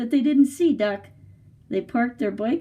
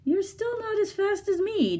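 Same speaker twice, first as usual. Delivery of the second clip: much higher, cocky horse voice; boastful horse; 12 semitones higher than usual. Acted. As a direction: show off as the proud horse.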